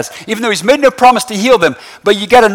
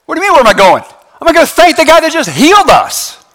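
climbing to a high, emphatic finish; incredulous retort; 7 semitones higher, 3 dB louder than usual. Acted. Healed leper answers his friends, incredulous. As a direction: answer as if it were obvious.

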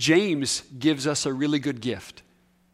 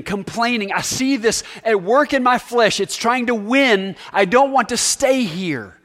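higher, louder pleading prayer; earnest prayer; 6 semitones higher, 6 dB louder than usual. Acted. Preacher voicing a believer's confession to the Lord, loud earnest prayer. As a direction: pray it out earnestly.

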